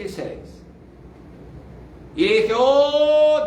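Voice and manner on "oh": awed exclamation. Retold or imitated. Recalled cry out to God.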